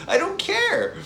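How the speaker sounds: squeaky high I don't care; shrill-free high exasperated toss-off. Real, not acted. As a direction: pitch it way up in disbelief. Exasperated dismissal.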